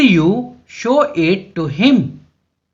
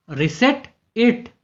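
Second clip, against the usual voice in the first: reset it drawn out slowly, ending on it; slow clear model; 3 semitones higher, 7 dB quieter, much slower than usual. Shown as a demonstration. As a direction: say reset it slowly so learners catch it. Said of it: "इसे वापस सेट करो". (धीरे-धीरे, साफ़ बोलकर)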